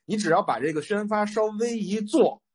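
ordinary talk, nothing marked

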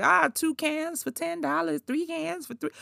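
high, hawking sales pitch; mock salesman. Imitating stores selling Lysol, high hawking pitch. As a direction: call out the deals like a vendor. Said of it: "they out here like".

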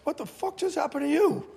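high, shocked Australian boss, a light imitation. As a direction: play the Australian chairman aghast at the bruises. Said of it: "he's Australian".

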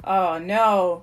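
loud, drawn-out groan of dread; weary, exasperated moan. A narrator's reading. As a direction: groan it in dismay. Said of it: "groaned Rudy".

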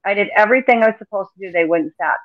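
normal talking voice

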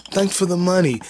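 high, mocking put-on voice of a movie goon; mock thug voice. Goons taunting the man they owe money. Acted quote of movie characters.

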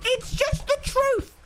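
mock solemn repeat. Streamer repeating his claim, high slow echo. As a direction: stretch it out again.

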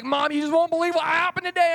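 high, loud, rushed burst; excited girl. Acted. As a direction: gush to mom at top speed. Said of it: "She's so excited".

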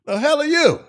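ordinary delivery

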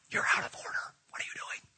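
hissed whisper telling him off; whispered church scolding. Retold or imitated. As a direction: whisper sharply, like shushing in church. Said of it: "Shut up".

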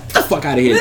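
ordinary talk

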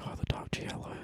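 breathy whispered fanboy gush; whispering. Retold or imitated. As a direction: whisper it like a starstruck fan. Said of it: "and they're like".

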